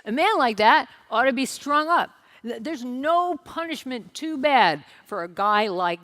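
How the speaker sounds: loud indignant outburst; outraged. Acted. King David outraged at the rich man, loud indignant. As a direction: rage at the imagined rich man. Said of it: "And David is outraged".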